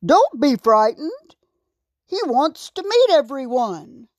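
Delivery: shout. A narrator's reading. King reassures animals fleeing the giant, calling out. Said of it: "King Aaron shouted".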